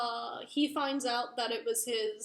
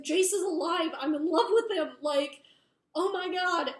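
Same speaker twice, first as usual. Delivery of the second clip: higher, louder retold outburst; exasperated insistence; 5 semitones higher, 6 dB louder than usual. She tells his siblings he is alive, exasperated acted protest. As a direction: plead to be believed, acted out with exasperation.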